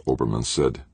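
usual speaking voice

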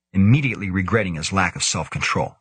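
ordinary delivery, nothing marked

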